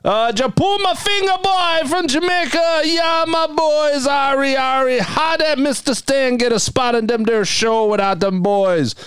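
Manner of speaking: high, slow, shouted Jamaican patois voice; Jamaican accent. Retold or imitated. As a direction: belt it out like a hyped Jamaican fan. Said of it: "understands what he says".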